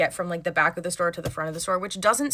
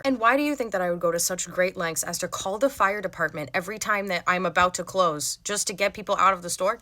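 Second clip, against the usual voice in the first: ordinary delivery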